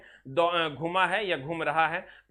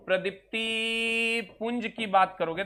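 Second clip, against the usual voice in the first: slower, word by word; slow dictation; slower than usual. Shown as a demonstration. Teacher repeating phrase for students to note, slowly. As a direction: draw it out so they can write.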